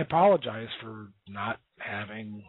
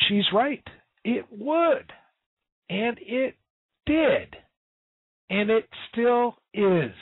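emphatic agreement; 10 semitones higher, slower than usual. Real, not acted. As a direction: hammer each short phrase home. Host emphatically agrees on air.

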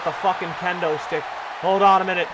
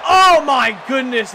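excited shout; 5 semitones higher, 7 dB louder, slower than usual. Real, not acted. Wrestling commentator reacts to big move.

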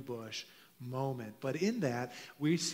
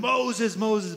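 much higher and louder, urgent calling; urgent raised double call of the name; intense call; 6 semitones higher, 7 dB louder than usual. Retold or imitated. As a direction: call out sharply to get his attention. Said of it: "read into it kind of an intensity".

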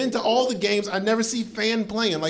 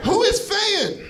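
baffled question; 11 semitones higher, slower than usual. Acted. Confused rant about fan jersey.

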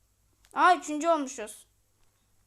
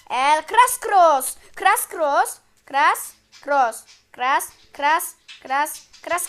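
drawn-out announcing; 7 dB louder, much slower than usual. Pretend. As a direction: stretch El Crash Cross slow and loud like a ring announcer. Karakter adını uzatarak seslendiriyor. (ağır ve yüksek sesle sunucu gibi uzatarak)

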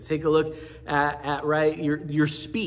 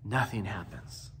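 dropped low and much softer, deadpan; deadpan letdown; 6 semitones lower, 10 dB quieter than usual. Acted. Preacher undercutting the suspense, deadpan drop. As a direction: let it fall flat after the buildup. Comic letdown after buildup.

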